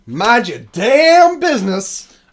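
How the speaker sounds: slow, loud, high angry yell; angry shout. Retold or imitated. Kevin Hart-style genie yelling.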